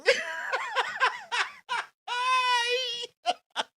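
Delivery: high shrieking laugh; loud howling laugh. Real, not acted. Host hears the obesing line, howling laugh. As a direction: lose it completely. Reading absurd quote.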